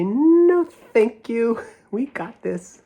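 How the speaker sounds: high squeaky polite mice; high tiny mouse voices. Retold or imitated.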